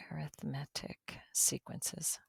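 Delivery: hushed whisper, drawn out; soft, voiceless savoring of the words. Shown as a demonstration. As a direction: slowly whisper the term for effect. Teacher playfully repeats the fancy term.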